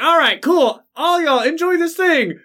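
high upbeat mock cheer; cheery self-quote. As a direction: chirp it out carelessly. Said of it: "I was like".

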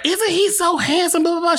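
put-on very high gushing older lady voice; high imitated older lady. Stranger at party gushing to him, very high mock voice. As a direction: squeal it like an excited stranger.

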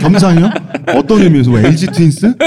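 amused surprise, rising and bright; surprised. Real, not acted. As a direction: caught off guard by the word, laughing. Unexpected word, laughing question. (웃으며 놀라 되묻는)

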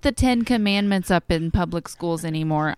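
put-on loud whiny Wisconsin mom accent; complainy Midwestern mom voice. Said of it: "my Wisconsin accent".

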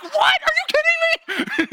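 shrill "What?" as the outraged wife; imitating wife's outrage. Husband retelling wife's reaction to no ticket, mock outrage. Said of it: "She's like".

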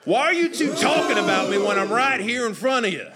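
high, indignant Southern holler; Southern accent. Acted. Father overhears children discussing him, loud Southern accent. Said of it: "deep Southern accent".

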